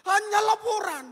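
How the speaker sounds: loud, high rebuke from the pulpit; preacher's loud scolding; emphatic preaching. Real, not acted. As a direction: hammer the point home. Preacher chides prayer as report.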